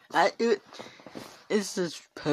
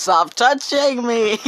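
high, loud, slow shriek of protest; hammed-up panicked passenger yelling; mock shriek; 9 semitones higher, 9 dB louder, slower than usual. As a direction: shriek it out, drawn long. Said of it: "I'll voice over it for you".

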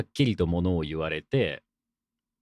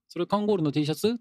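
higher-pitched curious question; acted casual query, pitched up; curious questioner; 9 semitones higher, faster than usual. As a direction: play someone asking about your shirt, voice lifted. Voices someone asking about the shirt.

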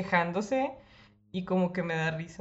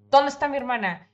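"¿Dónde está mi hermana?" repeated higher and louder, "dónde" hit hard; louder angry repeat; 6 semitones higher, 6 dB louder than usual. Retold or imitated. Gripping the guy's shirt, demanding her sister again, louder. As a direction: shout the question the second time. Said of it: "lo agarré de la camisa".

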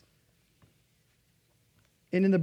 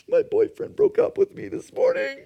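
much higher girlish voice, mildly imitated; mock tearful girl; 13 semitones higher than usual. Preacher imitating the crying girl after the sermon, high-pitched. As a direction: voice the weeping girl's plain answer. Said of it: "And she's like".

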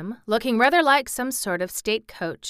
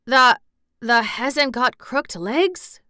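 slow, raised, faltering question; quavering, hoarser; 4 semitones higher, 6 dB louder, slower than usual. A narrator's reading. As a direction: quaver it out hesitantly. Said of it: "more hoarsely yet".

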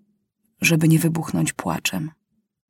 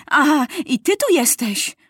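raised high, almost a shout; half-shouted; 8 semitones higher than usual. A narrator's reading. Maciuś bursts in on the journalist, half shouting. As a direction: catch them red-handed with a sharp cry. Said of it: "krzyknął raczej, niż powiedział".

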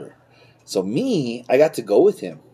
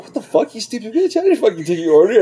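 mock indignant outburst; 10 semitones higher than usual. Retold outburst at customer.

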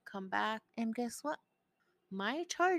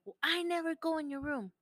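put-on higher, whiny teen voice; mocking teen son; 8 semitones higher, 3 dB louder than usual. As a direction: imitate her son's excuse. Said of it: "And he's like".

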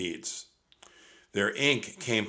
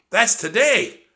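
alarmed; 13 semitones higher, 11 dB louder than usual. A narrator's reading. Jack realizes danger.

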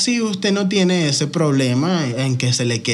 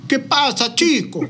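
higher, hammed-up Venezuelan "¿Qué pasa, chico?"; mock Venezuelan accent; 10 semitones higher, slower than usual. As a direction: imitate a Colombian faking a Venezuelan accent. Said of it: "Como tratando de imitar ... el acento".

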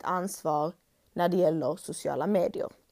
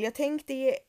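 ordinary delivery

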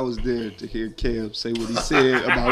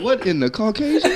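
mock shocked; 8 semitones higher, 5 dB louder than usual. Father's mock shocked reply.

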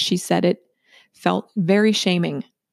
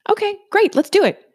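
cheerful agreement; 13 semitones higher than usual. Retold or imitated. New doctor agrees cheerfully.

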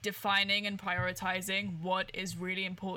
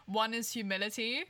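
ordinary talk

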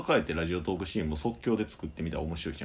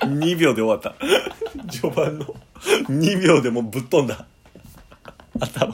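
laughing; 6 semitones higher, 9 dB louder than usual. Real, not acted. Laughing after botched ad.

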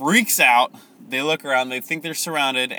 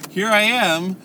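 high, giddy mock triumph; excited Gideon; 7 semitones higher, 3 dB louder than usual. Gideon overhearing the dream, hammed-up excitement. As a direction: crow it like a comic hero, building to the finish. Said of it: "he gets excited".

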